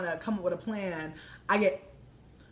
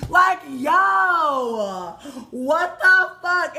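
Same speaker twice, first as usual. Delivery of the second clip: loud, high, drawn-out cry; outraged wail; 7 semitones higher, 9 dB louder, much slower than usual. Retold or imitated. Venting about a terrible night with friends, outraged wail. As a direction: wail it slowly in hammed-up outrage. Outraged outburst after echo.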